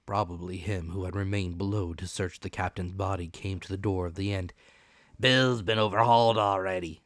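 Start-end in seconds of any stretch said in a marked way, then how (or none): 5.2-7.0 pirate report. Acted. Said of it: "said he".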